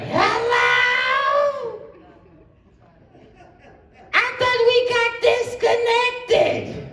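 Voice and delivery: very high squeaky falsetto, desperate woman; shrill over-eager falsetto on the phone. Acted. Ugly woman calls back.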